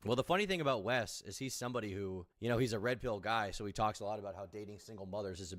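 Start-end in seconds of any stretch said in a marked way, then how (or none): none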